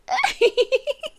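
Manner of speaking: brief high airy chuckle; soft amused laugh. Acted. Monster laughs at the uneasy human, airy and amused. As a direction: laugh softly at their discomfort. Monster recalls being unafraid of.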